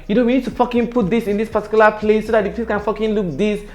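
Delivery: high, commanding boss giving orders; mock boss laying down the law; bossy boss voice. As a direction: play the pushy boss barking plans.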